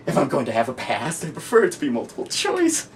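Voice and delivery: sudden manic lift from hush; Joker. Acted. Joker preferring a multiple choice past, rising to manic glee.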